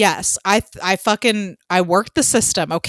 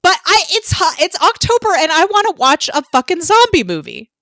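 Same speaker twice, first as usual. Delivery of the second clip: excited; 9 semitones higher, 5 dB louder than usual. Real, not acted. Excited justifying her pick.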